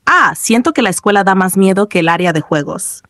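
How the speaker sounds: ordinary delivery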